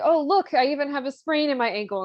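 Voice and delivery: high, loud show of proof; faking an injury. Retold or imitated. Figaro claims he jumped from the window, high and showy.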